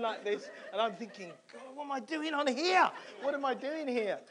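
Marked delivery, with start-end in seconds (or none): 1.5-4.2 loud, high-pitched alarmed outburst; hammed-up panicked shout; retold panic. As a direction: cry out in mock panic mid-ride. Retells panicking on the ride.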